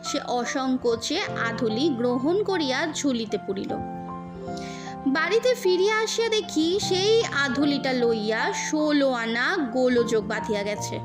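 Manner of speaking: ordinary delivery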